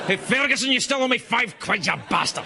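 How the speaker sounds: high, aggressive shout across the street; angry bar brawler. Retold or imitated. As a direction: collar a debtor, comically menacing. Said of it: "guys that remember me from a bar fight".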